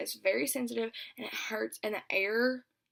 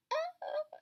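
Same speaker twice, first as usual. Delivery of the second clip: a thin breathy whimper of pain; retold cry, high and whispered; 4 dB quieter than usual. Girl in pain while pulling off her nail, a high whispered whimper. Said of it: "I'm crying".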